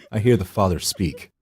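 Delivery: ordinary delivery, nothing marked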